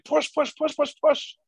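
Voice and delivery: the brain's squeaky cheer urging you on. Retold or imitated. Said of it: "And if your brain is going".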